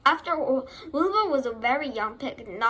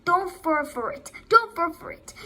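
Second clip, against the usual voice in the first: high, insistent plea, building louder; raised storybook warning; urgent warning; 5 semitones higher, 5 dB louder than usual. A narrator's reading. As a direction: warn him off, more forcefully on the repeat. Goose warns Wilbur of trick.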